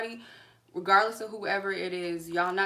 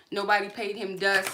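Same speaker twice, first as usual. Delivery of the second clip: usual speaking voice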